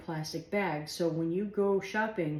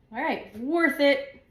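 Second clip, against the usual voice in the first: pleased exclamation; 7 semitones higher, 6 dB louder, slower than usual. Retold or imitated. Happy quote about the smile.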